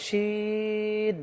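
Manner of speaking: শিন stretched long and said heavy; heavy shin. Shown as a demonstration. Teacher demonstrating the dotted letter to viewers, drawn out. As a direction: hold শিন long with a full sound. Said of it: "এটাকে শিন মোটা করে বলতে হবে".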